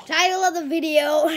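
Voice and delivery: loud, high announcer voice; announcer call. Acted. Vlogger proclaims the video title to viewers, loud announcer voice. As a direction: proclaim the title like a show host.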